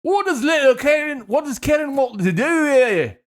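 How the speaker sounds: high, loud mock-British parent; badly faked Scottish accent drifting British; hammy high-pitched British parent voice; botched British accent.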